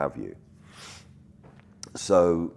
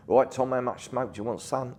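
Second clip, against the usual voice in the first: a lightly voiced old crew bloke, higher and matey; easy, unbothered old geezer calling Tom son; 8 semitones higher than usual. Smoke operator chats casually to Tom on set, matey.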